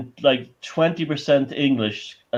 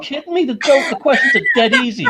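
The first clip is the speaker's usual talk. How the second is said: scoffing disbelief; 11 semitones higher, faster than usual. Retold or imitated. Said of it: "And I said".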